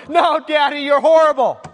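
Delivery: put-on childish protest against a strict father; whiny child. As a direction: whine at daddy like a wronged toddler.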